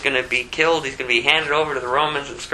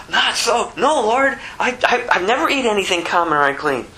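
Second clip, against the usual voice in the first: a touch higher, quick anxious protest; Peter protesting; 8 semitones higher than usual. Retold or imitated. Peter refusing the Lord's voice, protesting quickly. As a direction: plead with the Lord, flustered.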